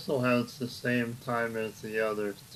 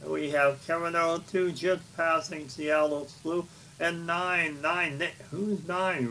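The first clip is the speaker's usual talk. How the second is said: excited race call; 5 semitones higher than usual. Acted.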